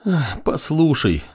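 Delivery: sighing. A narrator's reading. Apprentice pleading with girlfriend, sighing. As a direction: breathe out a drawn-out sigh as you start. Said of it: "вздохнул парень".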